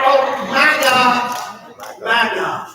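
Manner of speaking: loud, drawn-out cry to God. Acted. Jesus calling to God from the cross, crying out loudly. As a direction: call it out forcefully, stretching the words.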